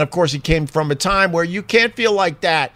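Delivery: ordinary delivery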